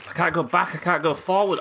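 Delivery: trapped and frantic; high, loud panic at being stuck; panicked. Real, not acted. Stuck in a death loop, panicking.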